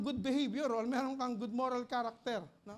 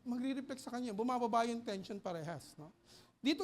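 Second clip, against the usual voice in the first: ordinary talk, nothing marked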